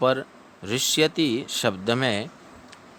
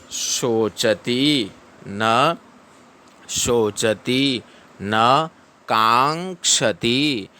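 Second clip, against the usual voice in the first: slow recitation; much slower than usual. Shown as a demonstration. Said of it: "teacher models verse recitation".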